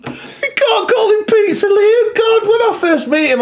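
high, crying with laughter; laughing hard. Real, not acted. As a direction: squeal it out through laughter. Laughing fit about Pizza Liam.

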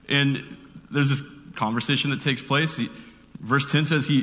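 ordinary delivery

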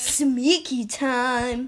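slow, low sneaky line; a hint of a sneaky thief; mock sneaky. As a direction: sneak the words out slowly. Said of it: "repeating the game voice line".

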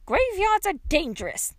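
put-on high folksy old-lady voice; dotty old woman, warning. A narrator's reading. Old woman lectures girl in the grave, high warning. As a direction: warn like a batty old woman.

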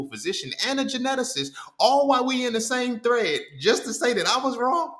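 indignant. Real, not acted. Indignant rant about thread.

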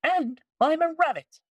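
very high little rabbit. Acted. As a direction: speak like a small bouncy rabbit. Rabbit speaks.

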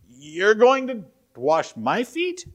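high, slow question stretched out, rising on going; slow incredulous question. Retold or imitated. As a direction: draw it out in disbelief. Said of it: "He says".

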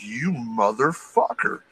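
slow, drawn-out retold curse; stunned mock outrage. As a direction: stretch each word in slow disbelief. Repeats retold reaction to injury.

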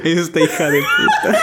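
high, laughing the words out; laughing insult. Real, not acted. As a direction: call her out while cracking up. Insulto entre risas. (insulto entre risas)

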